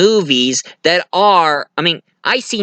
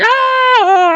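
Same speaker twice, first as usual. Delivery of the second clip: high, louder imitated scream; mock screaming; 4 dB louder than usual. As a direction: shriek in terror, high. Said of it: "going like".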